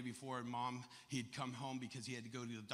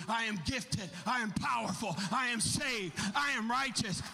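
loud rapid-fire sermon storytelling; driving, raised pulpit voice; full-force preaching; 13 semitones higher, 8 dB louder than usual. Real, not acted. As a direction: preach it hard to the congregation.

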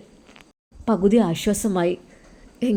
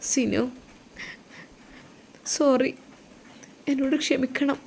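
tearful wife; 6 semitones higher, 6 dB quieter than usual. Acted. Wife apologises, husband asks why crying.

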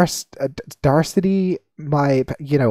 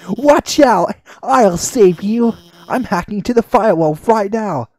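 higher, urgent heroic cry; eager rescuer; 5 semitones higher, 5 dB louder than usual. Acted. Rescuer breaking in to save the player, hammed-up urgent cry.

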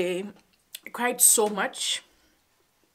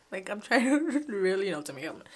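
laughing through tears. Real, not acted. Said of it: "I'm trying not to mess up my makeup".

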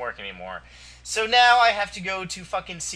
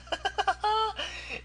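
squeaky, high, quiet giggle; a thin high laugh at yourself; 6 dB quieter than usual. Real, not acted. Laughing at his webcam photo, high giggle.

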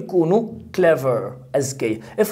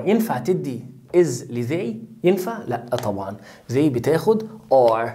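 ordinary talk, nothing marked

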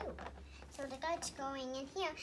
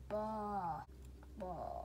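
daddy sheep bleat; 7 semitones lower, 3 dB quieter than usual. Pretend. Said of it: "This one's the dada".

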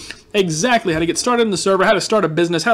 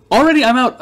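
louder and higher complaint; exasperated outburst; 6 semitones higher, 6 dB louder than usual. Real, not acted. Shop sold out again, loud mock dismay.

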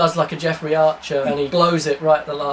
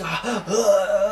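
a short shocked gasp; hammed-up gasp of shock; mock gasp; 3 semitones higher than usual. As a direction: gasp at the reveal. Shocked at president's return.

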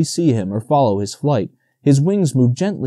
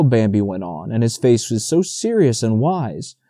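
ordinary delivery, nothing marked

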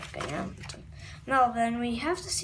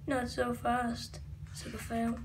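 villain voice; 7 semitones lower, 10 dB quieter than usual. Acted. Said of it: "I'm just doing the voices".

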